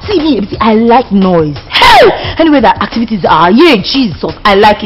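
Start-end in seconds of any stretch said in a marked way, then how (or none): 1.7-2.4 a sudden, very high shout; excited shout. Acted. Bragging to her housemaid about loving noise, a high shout. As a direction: yell it with glee.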